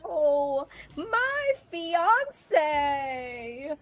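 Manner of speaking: high, wailing damsel lament; mock dismay. Heroine watches her fiance vanish, wailing in mock dismay. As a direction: draw out a hammed-up lament.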